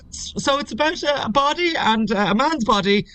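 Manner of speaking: high-pitched stumbling first attempt; nervous beginner. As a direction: imitate yourself fumbling a rehearsed pitch. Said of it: "When I first started doing it".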